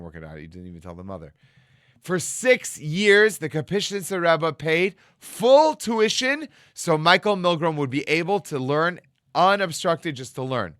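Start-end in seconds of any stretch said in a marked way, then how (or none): 2.0-10.7 higher, louder and slow; moved emphasis. Real, not acted. Telling how the rebbe paid, slow and swelling. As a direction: let the wonder swell on each word. Emotional climax of the story.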